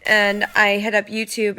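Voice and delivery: ordinary talk, nothing marked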